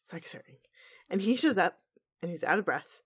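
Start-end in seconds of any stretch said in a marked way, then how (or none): 0.1-0.6 hushed dramatic whisper. Acted. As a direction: whisper it for suspense.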